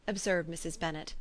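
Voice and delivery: plain reading voice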